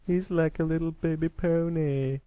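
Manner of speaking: high, put-on taunting voice; jeering young bully. Bully mocking a small colt.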